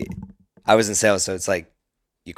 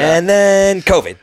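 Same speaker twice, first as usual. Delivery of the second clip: high and slow, drawn out; ironic punchline; 11 semitones higher, 10 dB louder, slower than usual. Real, not acted. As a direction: lean on it, mock dread.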